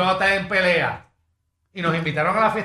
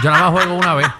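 laughing; 12 semitones higher, 3 dB louder than usual. Real, not acted. Joking about his own call.

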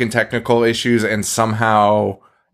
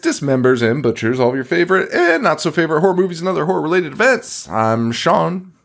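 announcer intro; 6 semitones higher, faster than usual. Acted. Host welcoming listeners, big announcer voice. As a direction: open the show like a carnival barker.

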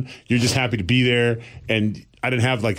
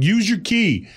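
higher-pitched retold call; imitated brotherly order, pitched up; mock calling out; 8 semitones higher, slower than usual. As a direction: call it through a window, raised pitch. Shouting through window at brother.

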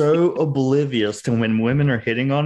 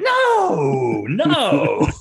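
drawn-out, high-pitched "no"; retold disbelieving denial; 7 semitones higher, 3 dB louder than usual. Oblivious man answering Victoria's hint, mock incredulous denial. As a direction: wail it in exaggerated disbelief, pitch leaping up.

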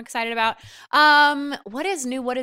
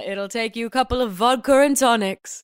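put-on British accent; 3 dB louder than usual. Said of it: "you hear his British accent in".

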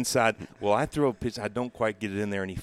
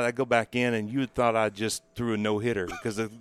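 usual speaking voice

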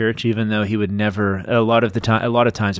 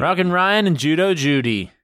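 high, bright echo of the names; excited echo; 8 semitones higher than usual. Acted. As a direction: cheer the names out. Echoing the team name.

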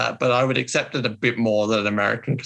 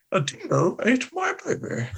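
mock Lindy Chamberlain; 10 semitones higher, 4 dB quieter than usual. Quoting famous Lindy Chamberlain line.

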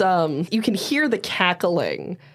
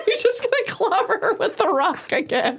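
laughing; 14 semitones higher than usual. Real, not acted. GM laughing at play.

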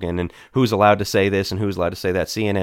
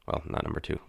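breathy aside under the breath; whispered self-correction; 13 dB quieter, faster than usual. Real, not acted. Correcting his own numbering, he whispers it under his breath. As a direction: drop to a near whisper, correcting yourself.

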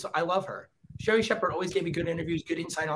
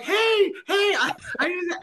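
much higher, louder call after a car; chasing shout; 4 dB louder, slower than usual. Retold or imitated. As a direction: yell to catch the driver. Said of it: "I'm like".

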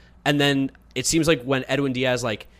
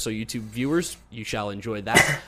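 usual speaking voice

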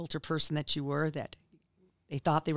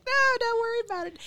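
much higher, sing-song polite brush-off; squeaky reassurance; 3 dB louder than usual. Retold or imitated. Host telling Angela to leave quilts, high squeaky reassurance. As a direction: pipe up like a flustered hostess. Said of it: "And I'm just like".

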